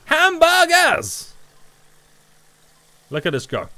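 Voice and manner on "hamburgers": long, high, loud sung-out call; hyped long-drawn shout; excited shout. Real, not acted. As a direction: belt it out like a game-show host. Gaming vlog excited call.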